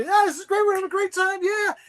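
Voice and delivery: high tipsy cheer; drunk and happy. Retold or imitated. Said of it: "we're all drunk and happy".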